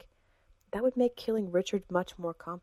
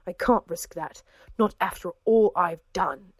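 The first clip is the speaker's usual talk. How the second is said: growled; 6 dB louder than usual. A narrator's reading. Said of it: "Richard growled".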